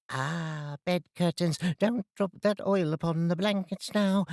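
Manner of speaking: a coarse London charwoman, pleased with herself. Acted. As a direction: cackle it out like a cheeky charwoman. Charwoman voiced in story.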